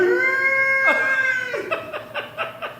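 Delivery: long, very high comic scream; hammed-up shriek of panic, held long; terrified squeal. Sister learns her cellmate is Bertha, mock terrified squeal.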